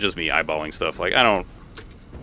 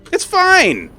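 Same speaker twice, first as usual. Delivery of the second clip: much higher, mock cheerful insistence; squeaky mock reassurance; 5 dB louder than usual. Streamer insisting his build is fine, squeaky. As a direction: pretend everything is fine, pitched way up.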